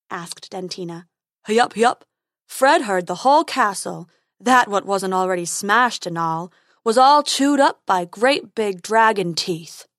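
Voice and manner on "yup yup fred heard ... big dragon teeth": troll Fred. Acted. Troll Fred voiced in story.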